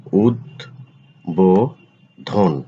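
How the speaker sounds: উদ্বোধন slowly as ud-bo-dhon; slow model pronunciation. Shown as a demonstration. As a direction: stretch উদ্বোধন syllable by syllable. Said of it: "আলোচনা করব শব্দের উচ্চারণ". (উদ্বোধন ধীরে উচ্চারণ)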